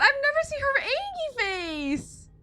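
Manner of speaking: high, slow, squealing delight; squealing excitement. Real, not acted. To chat, squealing delight at her angry face. Gushes over the character's face.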